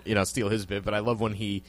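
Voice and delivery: ordinary talk, nothing marked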